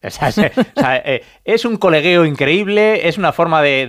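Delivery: normal talking voice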